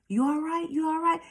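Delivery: imitating his put-on concern; mock concerned guy. A man asking the stumbling narrator with mock concern. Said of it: "he's looking at me all concerned".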